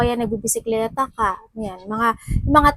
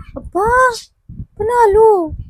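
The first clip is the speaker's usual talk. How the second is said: excited cheer; 11 semitones higher, 6 dB louder than usual. Real, not acted. Excited tarot reading win.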